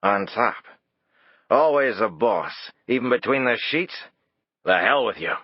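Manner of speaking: angry retort. A narrator's reading. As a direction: spit it back, drunk and offended. Drunk Lee angry retort.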